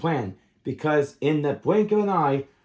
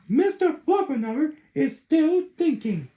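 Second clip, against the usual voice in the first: higher voiced mother; a lightly voiced patient mama; 11 semitones higher than usual. Mother answers her children, lightly voiced and patient.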